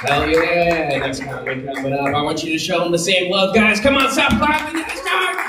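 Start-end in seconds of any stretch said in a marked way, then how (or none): 3.8-5.5 high-pitched hype call; rallying the crowd. Real, not acted. Host bringing on next comic, high rallying shout. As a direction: call out to whip up the room.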